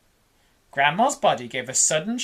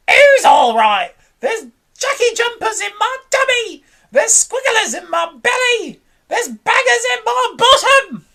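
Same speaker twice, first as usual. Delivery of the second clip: high, loud wailing complaint; wailing shout; 8 dB louder than usual. A narrator's reading. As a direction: howl about the pain inside. Grandma wailing about her belly.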